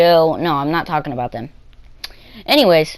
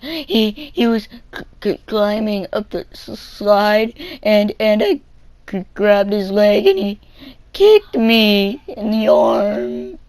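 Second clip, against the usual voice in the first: stuttering crybaby; 4 semitones higher, slower than usual. Retold or imitated. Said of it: "stuttering thing".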